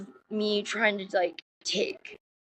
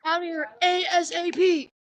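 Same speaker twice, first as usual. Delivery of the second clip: mock panicked daughter; 7 semitones higher than usual.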